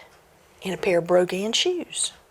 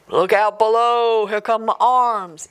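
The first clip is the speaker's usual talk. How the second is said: ghost voice from the chimney; 2 semitones higher, 4 dB louder than usual. Acted. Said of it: "the voice said".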